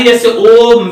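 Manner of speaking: ओम held long to show the extra length; ओम stretched long; drawn-out om. Shown as a demonstration. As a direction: sing out ओम slowly. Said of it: "और ज्यादा सा समय लगता है".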